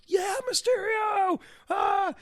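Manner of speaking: very high-pitched, drawn-out fan squeal; excited fan cheer. Retold or imitated. Comic book fan reacting to the villain announcement, mock-ecstatic squeal. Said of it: "were very excited, like myself".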